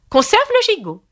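very high, commanding queen voice; imperious queen. A narrator's reading. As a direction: order the servants from on high. Red Queen orders the servants. (reine qui ordonne, voix très aiguë)

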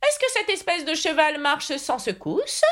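high, curious knight's question; very interested. A narrator's reading. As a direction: ask with keen interest in a high voice. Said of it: "d'un air très intéressé". (chevalier très intéressé, voix aiguë)

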